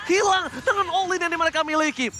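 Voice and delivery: hyped esports play-by-play; loud, stretched caster call; fighting game announcer. Real, not acted.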